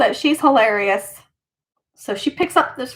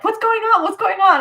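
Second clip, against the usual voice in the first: high, quick alarmed mom; a mother rushing in, voice pitched up; mom's worried voice; 8 semitones higher than usual. Retold or imitated. Imitating her mom asking the kids what happened, high and alarmed. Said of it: "my mom was like".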